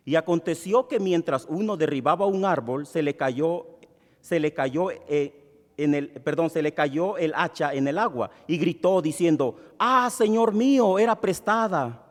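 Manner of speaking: measured Bible reading to the congregation; scripture reading. Acted. Pastor reading the passage to the church, steady reading. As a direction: read the verses out steadily for all.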